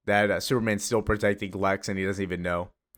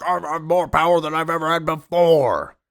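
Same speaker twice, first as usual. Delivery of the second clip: monster boast; 7 semitones higher, 6 dB louder than usual. Retold or imitated. Parasite breaks free, boasting.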